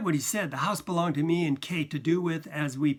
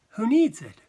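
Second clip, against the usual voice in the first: offhand; 8 semitones higher, 8 dB louder than usual. A narrator's reading. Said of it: "offhand smile".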